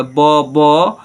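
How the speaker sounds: very slow, split ब, लू; sounding out syllables. Shown as a demonstration. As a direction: break it into ब and लू with a gap between. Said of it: "L double O से लू".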